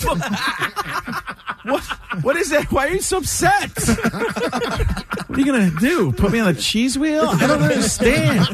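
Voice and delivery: very high, squeaky-pitched baffled protest; mock bewilderment. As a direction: play the flabbergasted nephew, voice way up. Acted bewildered reply to angry uncle.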